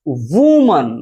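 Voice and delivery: वुमन with a short u; model word woman. Shown as a demonstration. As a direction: clip the u in वुमन. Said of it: "इसे पढ़ेंगे वुमन". (वुमन छोटे उ के साथ)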